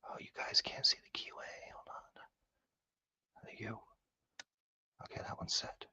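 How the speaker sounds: soft voiceless whisper, focused on the lock. Real, not acted. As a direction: whisper so you don't disturb the pins. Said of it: "need to shut up".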